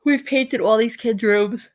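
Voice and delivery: talking through a yawn. Real, not acted. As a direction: say it mid-yawn. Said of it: "Oh my God, I'm yawning".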